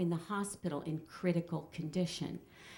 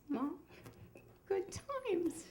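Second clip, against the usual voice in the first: high, soft imitation of a daughter; hushed aside; 12 semitones higher, 8 dB quieter, much slower than usual. Daughter to mom beside the casket, hushed and high. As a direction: murmur it small and high.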